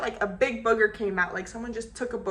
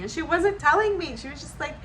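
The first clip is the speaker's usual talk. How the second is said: normal talking voice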